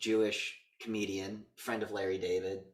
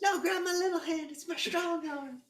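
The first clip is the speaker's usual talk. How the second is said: high whiny voice, a quoted comic bit; squeaky comic whine, way up high; high mock whiny voice; slower than usual. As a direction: whine it up high like a goofy sidekick. Quoting a Scary Movie character.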